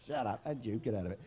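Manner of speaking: low, soft mock scold; low hush. Real, not acted. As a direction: hush the crowd gently. Hushing the laughing audience.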